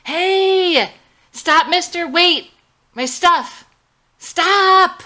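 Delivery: high, loud desperate yells; yelling after the cab. A narrator's reading. Madison yelling at the cab driver who drives off with her stuff, frantic shout. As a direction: scream at the departing cab to stop. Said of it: "yelling and hitting the truck".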